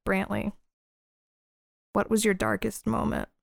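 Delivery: softly put, grave question; quiet, intent. Acted. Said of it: "gets quiet, and I look at him and I say".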